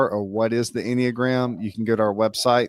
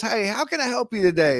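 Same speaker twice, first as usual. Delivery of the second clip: high, grinning customer-service voice; Sam; 11 semitones higher than usual. Retold or imitated. Store clerk greeting a customer, imitated with a huge grin. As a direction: imitate a relentlessly cheerful clerk, pitched way up through a big grin. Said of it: "this guy's just got the biggest grin. He's talking like this".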